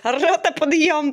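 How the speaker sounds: barked army wake-up; drill sergeant. Pretend. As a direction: yell it like a sergeant at reveille. Army wake-up call to teenager.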